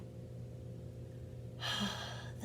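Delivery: long, soft breathy sigh. Acted. Gerald looks up at the moon, sighing. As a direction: let out a soft, wistful sigh. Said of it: "he whispered with a sigh".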